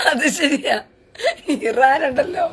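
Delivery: words breaking up with laughter; amused, laugh-choked delivery. Real, not acted. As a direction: talk through a real laughing fit. Breaking up laughing while commenting.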